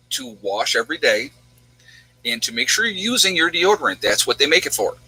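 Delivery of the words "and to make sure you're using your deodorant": usual speaking voice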